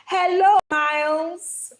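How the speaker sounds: loud, high sing-out hello; calling out. Real, not acted. Streamer hailing Miles as they join, calling out loudly. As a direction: call it out, stretching the name. Calling out greeting to new arrival.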